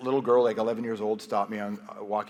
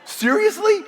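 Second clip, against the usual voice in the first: high, loud incredulous squeak; can't believe who her grandfather is; shot-up astonished question; stunned disbelief; 13 dB louder, slower than usual. Retold or imitated. Disbelief on meeting Jean Chrétien.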